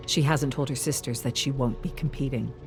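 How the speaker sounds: ordinary delivery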